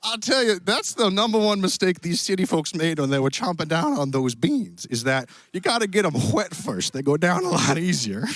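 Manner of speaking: put-on cowboy accent. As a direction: play a genial Western cowboy host. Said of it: "apparently some cowboy accent".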